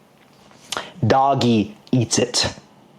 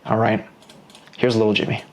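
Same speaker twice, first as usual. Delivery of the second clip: normal talking voice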